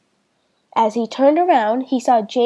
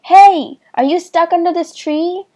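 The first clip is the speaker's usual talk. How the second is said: calling down; 6 semitones higher, 5 dB louder than usual. A narrator's reading. Boy calling down to trapped girl.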